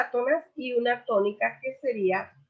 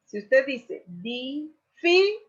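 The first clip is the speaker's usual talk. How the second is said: slow delivery with difícil stressed on fí; slow model phrase; 3 semitones higher, slower than usual. Shown as a demonstration. Teacher slowly models difícil to the class. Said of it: "la mayor acentuación cae en fi".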